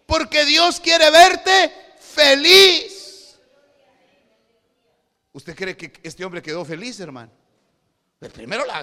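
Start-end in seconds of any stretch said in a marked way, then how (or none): 0.1-3.4 very slow, loud, high proclamation; full-force preaching. Real, not acted. Preacher telling the congregation, slow booming shout. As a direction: stretch every word out loud.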